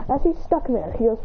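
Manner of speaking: ordinary delivery, nothing marked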